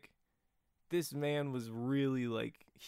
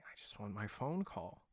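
lightly voiced Joker, low and soft; quiet menacing Joker; 4 semitones lower, 8 dB quieter than usual. Retelling the Joker's line in the cell, low Joker voice. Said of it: "He's like".

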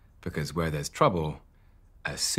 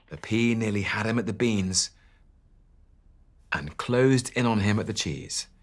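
building tension; 4 semitones higher than usual. A narrator's reading. Chase narration builds.

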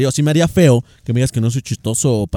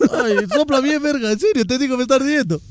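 higher, softer voice, pleading with a stinging eye; real discomfort, higher-pitched and pleading; pained pleading; 6 semitones higher, 5 dB quieter than usual. Real, not acted. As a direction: whine for help with something in your eye. Something got in his eye.

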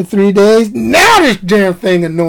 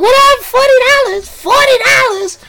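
high, shrill-pitched indignant squawk at the price; outraged disbelief. Retold or imitated. Beach lady balks at forty-dollar chair, outraged disbelief. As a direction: play the lady who can't believe the price.

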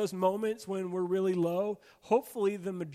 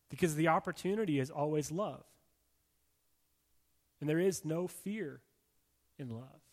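tender preaching; 6 semitones lower, 3 dB quieter than usual. Acted. Preacher reassuring a congregation at its low point, tenderly. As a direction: ease down and comfort them gently.

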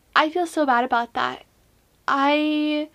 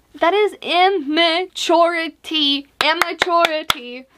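"immaturity" punched out syllable by syllable; higher, exaggerated stress on "immaturity"; emphatic scolding; 3 semitones higher, 3 dB louder than usual. Real, not acted. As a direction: hammer the word "immaturity". Mock condemning her own behaviour.

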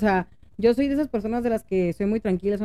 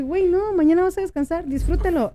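excited self-talk; 6 semitones higher than usual. Retold or imitated. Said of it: "me cayó el veinte y dije".

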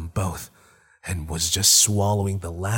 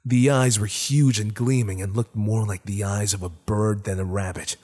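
plain reading voice

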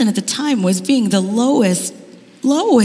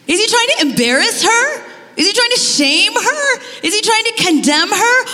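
incredulous questions; 9 semitones higher than usual. Real, not acted. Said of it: "I stop in this moment and I think".

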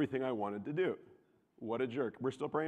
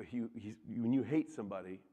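ordinary talk, nothing marked

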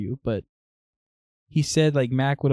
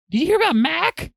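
high-pitched, urgent mimicked question; imitating frantic sister; 3 dB louder than usual. Sister breaking news over FaceTime, mimicked high and urgent. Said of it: "That's how she's talking to me".

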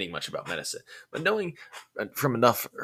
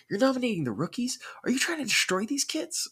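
high, disbelieving protest; bewildered. Acted. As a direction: voice leaps up in bewildered alarm. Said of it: "He said in bewilderment".

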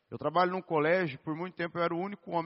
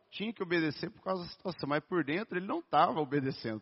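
ordinary delivery

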